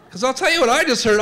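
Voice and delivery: ordinary talk, nothing marked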